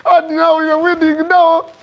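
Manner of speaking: high wailing accusation; acted hysterical tearful tirade; crying outburst. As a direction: wail and rail at him, pitched far up. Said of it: "as she's crying and beating everything".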